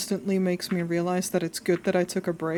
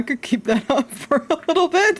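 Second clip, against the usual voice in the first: laughing; 6 semitones higher, 4 dB louder than usual. Real, not acted. Laughing while teasing chat.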